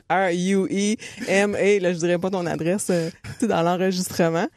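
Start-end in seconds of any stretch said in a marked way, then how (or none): 0.1-1.8 each letter said slowly and separately; spelling out letters. Retold or imitated. Spelling the street name for the police officer, slow and separate. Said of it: "tu te mets à épeler".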